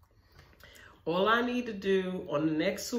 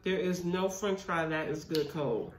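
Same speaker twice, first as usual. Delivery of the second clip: food near the mouth; talking between bites of food; eating while talking. Real, not acted. Seen 1: Left speaker holds mac and cheese cup and loaded fork at mouth while talking.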